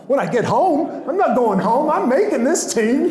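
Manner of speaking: high, loud indignant protest; indignant disbelief. Retold or imitated. Rookie refusing the coach's card, loud and high disbelief. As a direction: protest that you're not going home.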